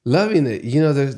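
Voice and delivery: gushing proud new dad; delighted. Real, not acted. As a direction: let the joy burst out as you answer. Asked how being a dad is.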